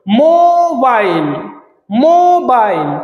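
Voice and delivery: মোবাইল drawn out slowly as মো-বাইল; syllable by syllable. Shown as a demonstration. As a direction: stretch both syllables of মোবাইল. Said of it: "দুটো syllable এখানে". (দুই ভাগে টেনে মোবাইল)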